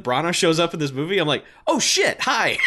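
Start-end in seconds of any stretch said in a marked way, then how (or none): 1.7-2.6 high-pitched surprised greeting; delighted surprise. Acted. Viewer spotting a favorite actor in the film, delighted surprise.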